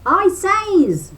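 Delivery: high, loud rabbit shout. A narrator's reading. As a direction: yell it to be heard. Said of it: "shouted Brer Rabbit".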